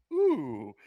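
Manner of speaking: mock-impressed high ooh; sarcastic ooh. Real, not acted. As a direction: fake wow, dripping sarcasm. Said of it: "that was a complete sarcastic noise".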